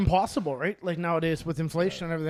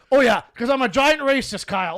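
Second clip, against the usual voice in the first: louder, higher sarcastic reply; mock sarcastic; 6 semitones higher, 8 dB louder than usual. Big guy mocking his friend's intro, loud sarcasm. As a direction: lay the sarcasm on thick. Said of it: "he's like".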